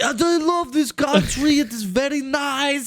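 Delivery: put-on high, drunken made-up Italian accent. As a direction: play a drunk guy faking an Italian accent. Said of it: "what I would think is an Italian accent while I'm drunk".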